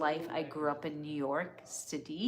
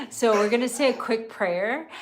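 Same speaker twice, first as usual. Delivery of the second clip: louder, laughing it out; 5 semitones higher, 8 dB louder than usual. Real, not acted. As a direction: laugh through the words. Laughing after breathless aside.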